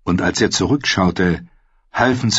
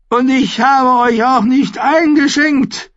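put-on much higher, louder and slow, a bratty young king; petulant boy king; 5 dB louder than usual. A narrator's reading. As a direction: drag it out like a spoiled royal child. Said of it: "sagte Joffrey".

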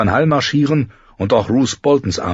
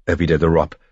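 usual speaking voice

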